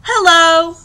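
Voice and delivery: high, loud storybook hello; shouted greeting. A narrator's reading. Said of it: "shouted a, a tortoise".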